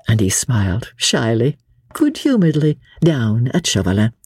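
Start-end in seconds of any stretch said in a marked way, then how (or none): none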